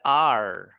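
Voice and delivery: "आर" low and drawn out, r not rolled; English R. Shown as a demonstration. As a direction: let "आर" glide into a smooth r. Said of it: "जब आपको ये वाला word निकालना आ गया". (बिना लुढ़काए "आर")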